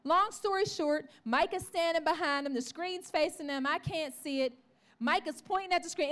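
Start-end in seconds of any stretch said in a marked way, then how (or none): none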